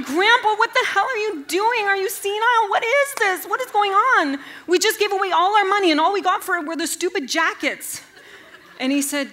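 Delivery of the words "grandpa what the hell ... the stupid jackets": high, loud outraged rant; exasperated yell. Retold or imitated. Granddaughter yelling at laughing grandpa, high loud exasperated rant. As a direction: scream at him in disbelief.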